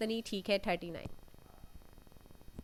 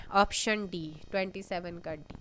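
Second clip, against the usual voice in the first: normal talking voice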